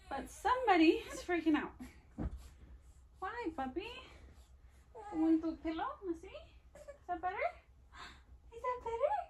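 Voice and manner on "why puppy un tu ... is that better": high hushed comforting coo. Real, not acted. Mother soothing crying baby.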